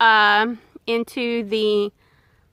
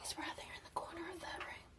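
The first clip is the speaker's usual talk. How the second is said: whispering; 5 semitones higher, 28 dB quieter, faster than usual. Real, not acted. Whispering near a wild animal.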